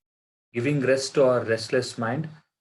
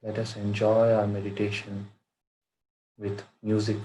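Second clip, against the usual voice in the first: measured meditation guide with long pauses; guided meditation; 2 semitones lower than usual. Acted. Teacher guiding friends to watch their breath, guided meditation. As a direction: lead them into stillness, phrase by phrase, in an even tone.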